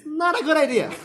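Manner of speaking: loud, high laughing punchline. Real, not acted. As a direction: burst out laughing on it.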